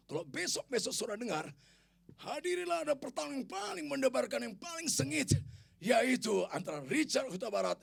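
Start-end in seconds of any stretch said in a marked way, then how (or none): 2.2-7.8 high, loud promotional call; mock fight announcer. Preacher advertising a fierce fight, loud announcer call. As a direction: hype the bout like a ring announcer.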